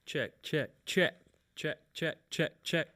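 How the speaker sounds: mic check. Real, not acted. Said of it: "Turn the gain on [name]'s mic down".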